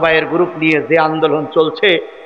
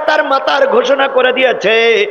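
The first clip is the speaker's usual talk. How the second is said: fervent preaching; 11 semitones higher than usual. Acted. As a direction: preach it up to the rafters. Preaching at full force.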